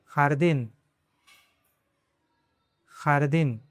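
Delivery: खारदीन with a throaty kh for j; खारदीन the Spanish way; Spanish jardín. Shown as a demonstration. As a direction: rasp the kh at the start of खारदीन. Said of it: "इसका sound है ख".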